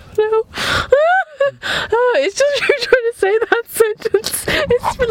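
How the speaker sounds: high voice breaking up with laughter; real, squealing amusement; laughing helplessly. Real, not acted. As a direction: try to explain through giggles. Guest laughing at repeated failed attempts.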